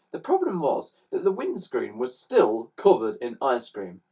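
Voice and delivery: ordinary delivery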